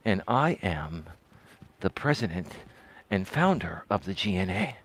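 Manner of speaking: soft, low ASMR voice, close to the mic. Shown as a demonstration. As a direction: murmur it low and hushed like an ASMR channel intro. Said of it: "A S M R tone is like".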